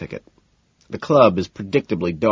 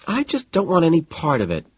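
prim woman; 9 semitones higher than usual. A narrator's reading. Evelyn's voice.

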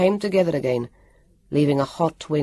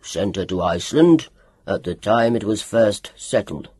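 low growled doctor's voice; a low menacing growl; 6 semitones lower, 3 dB louder than usual. A narrator's reading. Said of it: "growled Doctor Welander".

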